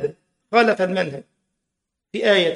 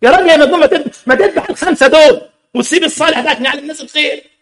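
louder, higher, mock indignant outburst; mock outraged retort; 5 semitones higher, 5 dB louder, faster than usual. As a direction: scold the father like he's mad. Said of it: "تقول له". (استنكار غاضب ساخر بصوت عالٍ)

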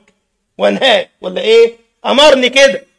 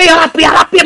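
mocking repeat; 6 semitones higher than usual. Mocking the loud prayer.

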